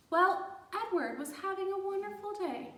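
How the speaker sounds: usual speaking voice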